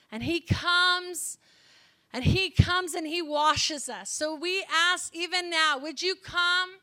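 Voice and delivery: slow, lifted prayer voice; preaching prayer call. Acted. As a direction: pray it out to the room, slow and rising. Closing prayer ministry.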